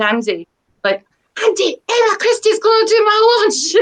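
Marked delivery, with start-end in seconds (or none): 1.4-3.7 high, excited call down to her husband; excited shout. Retold or imitated. Author calling down to husband Andy about her launch, excited shout. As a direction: yell the good news downstairs, delighted. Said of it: "shouting down at my husband".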